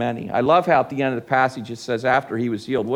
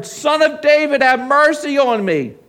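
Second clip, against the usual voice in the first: crying out harder; 10 semitones higher, 5 dB louder than usual. Acted. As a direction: cry out all the more insistently for mercy. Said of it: "crying out all the more".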